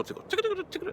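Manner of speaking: high squeaky tickle noises; high cartoon trickle sounds; tear drip sounds. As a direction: mimic tears trickling, high up. Imitating tears dripping.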